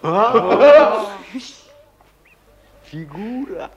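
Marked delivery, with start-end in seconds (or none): 0.0-1.1 loud triumphant "А?"; excited shout. Acted. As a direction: shout it out, proud of the idea. Pitching the commissar matchmaker idea.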